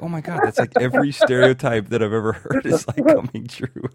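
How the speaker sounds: loud, amused, breaking into laughter; real laughter creeping into the words; laughing in disbelief. Real, not acted. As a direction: crack up while exclaiming, slowing at the end. Host cracks up at misheard stereotype.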